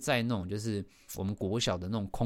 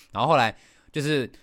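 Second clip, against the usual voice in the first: normal talking voice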